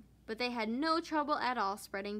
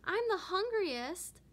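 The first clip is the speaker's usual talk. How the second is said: arguing; 8 semitones higher, 3 dB louder, slower than usual. Acted. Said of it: "Lottie argued".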